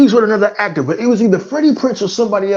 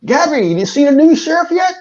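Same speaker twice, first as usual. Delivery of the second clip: put-on much higher, cackling old-timer voice; old prospector Gabby; 7 semitones higher, slower than usual. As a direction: squeak it out like a toothless old coot. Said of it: "the old prospector Gabby".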